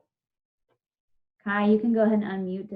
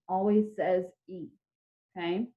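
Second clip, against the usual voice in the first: usual speaking voice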